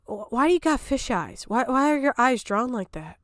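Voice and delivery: ordinary talk, nothing marked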